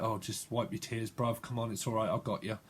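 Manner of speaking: soft, low reassurance; hushed nursing tone; soothing comfort. Acted. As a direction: comfort someone in tears, gently. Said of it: "someone was nursing back".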